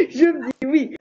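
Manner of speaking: laughing through emotion; teary laugh while talking. Real, not acted. Moved, laughs about the post.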